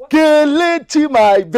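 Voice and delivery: high, drawn-out endearment; gleeful sweet-talk. Acted. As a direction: stretch out her name with delight. Suitor gleefully calls his beloved.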